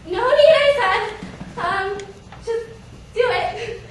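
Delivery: high, loud, halting protest; awkward comic panic; panicked refusal. Acted. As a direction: hurriedly fend him off, flustered. Embarrassed, refusing to be looked at.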